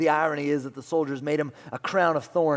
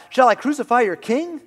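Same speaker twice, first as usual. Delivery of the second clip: Pilate asking; 11 semitones higher, 11 dB louder than usual. Retold or imitated. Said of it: "Pilate asked".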